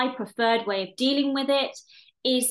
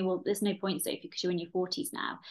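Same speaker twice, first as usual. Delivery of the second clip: softer, fast, muttered inner critic; 3 semitones lower, 7 dB quieter, faster than usual. Retold or imitated. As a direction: rattle it off low like a nagging voice in your head. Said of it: "and that voice saying to me".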